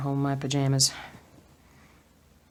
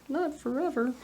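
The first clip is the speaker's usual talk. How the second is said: mock high; 11 semitones higher than usual. Playful high echo.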